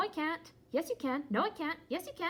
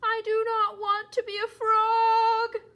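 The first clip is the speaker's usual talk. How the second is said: panicked shout; 8 semitones higher, 11 dB louder, slower than usual. Acted. Gerald panics about turning frog.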